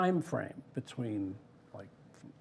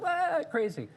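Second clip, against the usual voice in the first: much higher, tossed-off exclaim; Michael's exclamation; slower than usual. Retold or imitated. Michael reacting to a wild idea, pitched far up. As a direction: squeal it like an excited boss.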